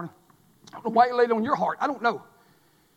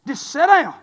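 ordinary talk, nothing marked

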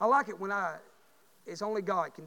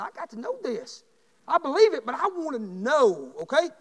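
insistent question; 7 semitones higher, 10 dB louder than usual. Retold or imitated.

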